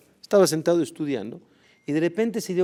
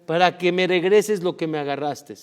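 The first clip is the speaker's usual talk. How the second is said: loud, higher, slow shout of forgiveness; 7 semitones higher, 12 dB louder than usual. Acted. Old rabbi shouting after the thief, loud and fervent. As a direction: call after the fleeing thief with fervent mercy. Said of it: "le grita y dice".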